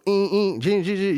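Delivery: high imitated buzzing of the tattoo gun; mock machine whine. Shown as a demonstration. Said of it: "You hear it".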